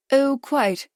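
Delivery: a silly, smiling high chirp; smiling fatuously. A narrator's reading. Charles agreeing with the adviser, fatuous smile. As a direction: simper like a dim-witted lord. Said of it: "smiling fatuously".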